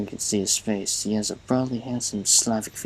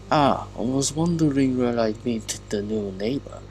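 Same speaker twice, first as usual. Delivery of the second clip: put-on Russian accent, pitched up a little; 3 semitones higher, 4 dB louder than usual. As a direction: greet them in a hammed-up Russian accent. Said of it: "should have done it in a Russian ac".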